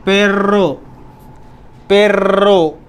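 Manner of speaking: पेर्रो with a long trilled rr in the middle; rolled double r. Shown as a demonstration. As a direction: roll the rr in पेर्रो hard and long. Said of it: "आर को खींचते हैं".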